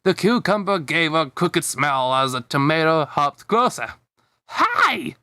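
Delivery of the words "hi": a sudden squeaky high little hi; bursting with kid excitement; child's high greeting. Acted. Young cucumber greets Bob in a squeaky high voice.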